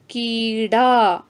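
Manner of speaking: कीड़ा drawn out slowly, each sound clear; slow demo word. Shown as a demonstration. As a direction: spell out कीड़ा slowly for pupils. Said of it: "ये शब्द है". (धीमे, साफ़ उच्चारण में)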